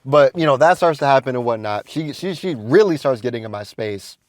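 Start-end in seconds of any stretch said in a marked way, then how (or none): none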